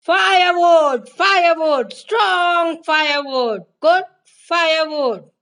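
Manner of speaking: loud, high street cry with long held words; vendor's shout. A narrator's reading. Firewood vendor crying his wares through the town, shouting. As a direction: call out your wares at the top of your voice. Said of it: "shouting at the top of his voice".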